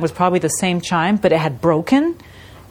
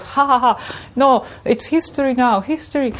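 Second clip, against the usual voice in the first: laughing shopkeeper; 7 semitones higher than usual. Retold or imitated. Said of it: "suddenly he starts laughing a lot".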